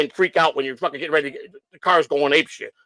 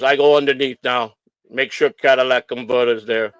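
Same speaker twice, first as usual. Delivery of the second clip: put-on lower-pitched Polish-accented English; 4 semitones lower than usual. Inspector announcing he checks underneath, Polish accent. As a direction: imitate a Polish mechanic's English. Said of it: "he sounded Polish to me".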